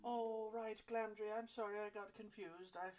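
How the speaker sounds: put-on high, apologetic old woman's voice; sorry and a little lost; old halfling woman. River apologising to Glamdry for mixing him up. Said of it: "she goes".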